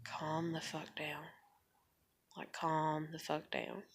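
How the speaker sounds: very soft, low and unhurried; quiet, unbothered. Retold or imitated. No longer mad at the ranter, quiet and low. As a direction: barely raise your voice, settle them down. Repeats her now unbothered reaction.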